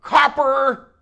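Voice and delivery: loud, high barked summons; booming, commanding shout; commanding yell. Acted. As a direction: call out sharply to command the whole room. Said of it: "He yelled in a most commanding voice".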